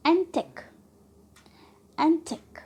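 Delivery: Antique pitched high and loud, stressing TIK; stress on suffix. Shown as a demonstration. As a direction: lean on 'टिक' in antique. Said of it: "suffix को trust देते हुए बोलेंगे". (Antique में 'टिक' पर ज़ोर)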